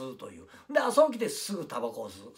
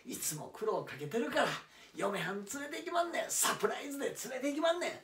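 excited; 7 semitones higher, 4 dB louder than usual. Acted. Excited about surprising his wife.

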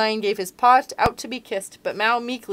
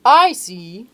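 lion voice; 2 semitones higher, 11 dB louder than usual. Acted. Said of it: "said Lion".

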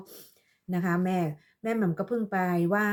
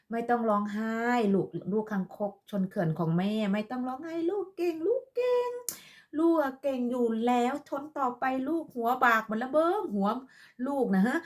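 sarcastic sing-song soothing, much higher; mock comforting; 3 semitones higher than usual. Vlogger mockingly consoling a rival she calls her toad child, sugary and higher. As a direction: coo at them like a fake-sweet mother.

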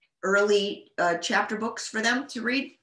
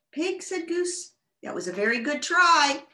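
Goose voice; 7 semitones higher than usual. Acted.

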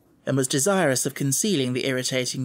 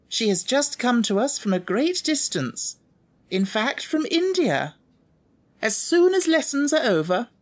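dignified headmistress; 10 semitones higher than usual. A narrator's reading. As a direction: call the class to order with dignity. Miss Minchin voiced.

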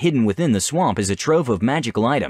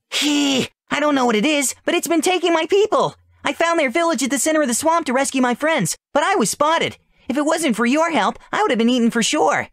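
very high, squeaky little voice; little mushroom creature, frightened. Acted. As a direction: speak like a tiny scared critter. Voices Sporal.

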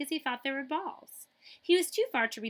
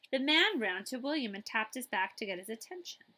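ordinary delivery

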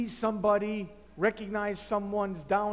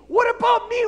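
even higher, louder repeat; louder outraged shout; 11 semitones higher, 7 dB louder than usual. Acted. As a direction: throw it back harder. Philemon argues with Paul.

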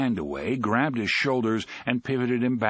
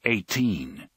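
usual speaking voice

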